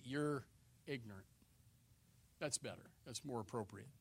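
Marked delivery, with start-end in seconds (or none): 0.0-1.3 polite correction. Shown as a demonstration. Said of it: "we will say".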